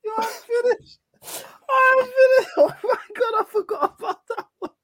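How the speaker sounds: high-pitched, helpless laughing through the words; can barely get it out for laughing; laughing till crying. Real, not acted. Cracking up at a friend's old clip, crying with laughter.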